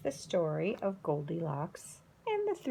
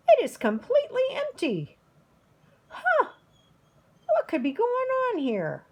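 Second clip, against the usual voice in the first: much higher, louder small bear voice; little baby bear; 14 semitones higher, 6 dB louder than usual. A narrator's reading. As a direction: squeak it out like a tiny cub. Wee tiny bear finds empty bowl.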